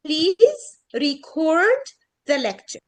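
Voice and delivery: record as ri-CORD, very slow, with long "cord"; second-syllable stress. Shown as a demonstration. Teacher modelling record as a verb, long stressed second syllable. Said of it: "ستريس يعني بطول السيلابل نفسها".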